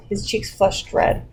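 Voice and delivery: ordinary talk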